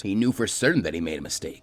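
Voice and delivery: ordinary delivery, nothing marked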